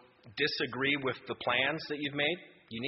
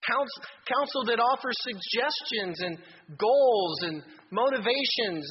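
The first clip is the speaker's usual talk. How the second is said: impassioned preaching; 6 semitones higher than usual. Acted. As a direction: lift the congregation with fervor. Preaching at full force.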